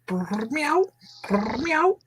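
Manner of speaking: high squeaky kitten purr and meow. Acted. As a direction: coo it like a happy kitten. Imitating a happy cat.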